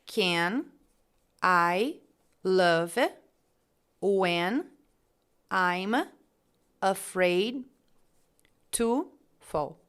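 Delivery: very slow, long pauses between words. Shown as a demonstration. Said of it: "Aí ele diz assim".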